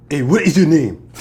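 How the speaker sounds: loud, forceful put-on voice of a friend; mock loud Nigerian greeting. Imitating his Nigerian friend Freddie shouting a greeting, loud put-on voice. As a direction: boom the question like a pushy friendly guy.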